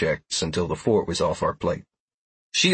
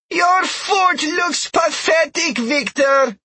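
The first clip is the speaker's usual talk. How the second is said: high, loud, slow taunting call; Trell shouting; 4 dB louder, much slower than usual. Acted. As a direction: jeer it across a long distance. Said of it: "just loud enough to be heard from forty yards away".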